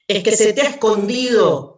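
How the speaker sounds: a second scoffer mocking quickly and loudly; mocking jibe. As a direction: tease him with a quick jeering shout. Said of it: "lo gastaba otro".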